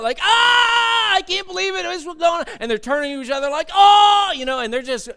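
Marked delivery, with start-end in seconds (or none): 0.2-2.5 high, loud scream easing into panicked wailing; hammed-up flipping out; mock freaking out. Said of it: "they're freaking out". 3.7-4.3 loud, high panicked "oh"; hammed-up wail of alarm; mock going-nuts scream. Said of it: "they're going nuts".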